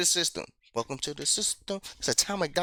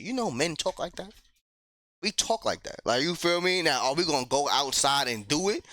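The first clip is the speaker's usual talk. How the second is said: ordinary talk, nothing marked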